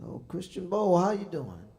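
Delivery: high, loud, overjoyed hello; hammed-up shouting into the phone; mock loud greeting. Teller mimics answering the young singer's call, hammed-up loud.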